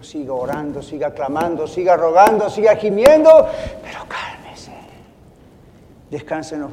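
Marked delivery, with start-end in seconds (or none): 1.7-3.5 building to loud and high; a preacher urging the faithful to keep crying out; rising cry. Acted. Building exhortation to keep praying. 3.8-4.7 sudden unvoiced "pero cálmese"; a preacher falling to a whisper after urging prayer. Drop to whisper after build.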